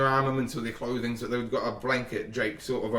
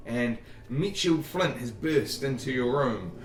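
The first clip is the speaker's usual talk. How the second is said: ordinary delivery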